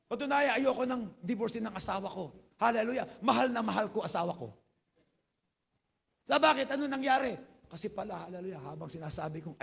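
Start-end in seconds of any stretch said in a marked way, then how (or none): 0.1-7.4 high, loud, driving sermon delivery; fervent preaching. Acted. Pastor telling the congregation the counselor story, preaching at full force. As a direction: preach it out with rising fire.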